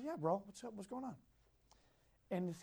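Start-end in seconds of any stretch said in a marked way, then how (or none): 0.0-1.1 very soft, easy answer; lightly imitating a relaxed reply; hushed casual reply. His own casual retold reply.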